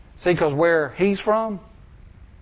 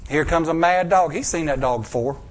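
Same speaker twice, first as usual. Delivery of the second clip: ordinary talk, nothing marked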